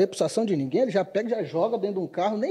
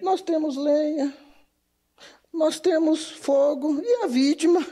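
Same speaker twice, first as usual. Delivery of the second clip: put-on slow, drawn-out Bahia accent; unhurried Bahia accent, rising question; 5 semitones higher, slower than usual. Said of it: "Você falando com sotaque baiano".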